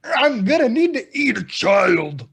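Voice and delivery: put-on high, loud dinosaur demanding a child; loud Barney. Barney demanding a child to eat, high hammed-up shouting. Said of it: "Barney's like".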